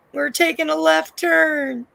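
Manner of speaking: high, slow drawn-out call; mock dramatic call. Joking about the show's turn, high drawn-out call. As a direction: stretch TURN out high and long.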